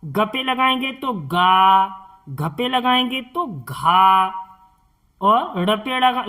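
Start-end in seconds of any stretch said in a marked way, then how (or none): 1.1-1.9 गा held long as 'gaaa'; long aa sound. Shown as a demonstration. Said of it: "इस तरह से इनका साउंड बदल जाता है". 3.6-4.3 घा held long as breathed 'ghaaa'; long aa sound. Shown as a demonstration. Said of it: "इस तरह से इनका साउंड बदल जाता है".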